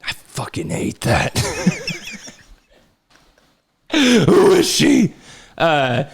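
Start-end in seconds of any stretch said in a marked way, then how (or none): 0.0-1.4 soft, unvoiced grumble under the breath; a hushed, irritated aside; muttered annoyance. Real, not acted. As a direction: mutter it low, barely voiced. Muttered annoyance at spam call.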